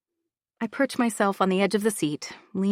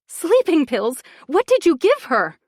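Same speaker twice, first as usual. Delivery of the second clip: high and loud, horrified; horrified demand; 9 semitones higher, 7 dB louder than usual. Acted. Laura demanding what Bronwyn gave her daughter. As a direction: demand it in horror.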